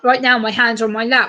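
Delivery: ordinary talk, nothing marked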